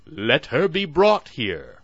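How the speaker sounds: loud, slow royal proclamation; stiff ceremonial announcing. Acted. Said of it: "said the chamberlain".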